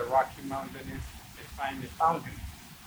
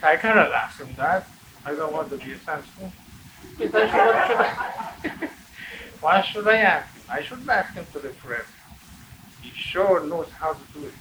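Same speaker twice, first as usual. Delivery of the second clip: laughing while talking; 2 semitones higher, 7 dB louder than usual. Real, not acted. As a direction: quote broken by laughter. Scared man, teller laughing.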